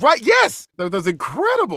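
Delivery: yes jumps way up in pitch, then settles; mock-dazzled rave; dazzled agreement. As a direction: play yourself gobsmacked. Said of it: "I was like".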